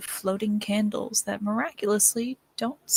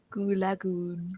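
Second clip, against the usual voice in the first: put-on slow, softer French-accented narrator; 5 dB quieter, slower than usual. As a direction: imitate the French narrator, drawing it out. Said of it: "I always love the way that he said".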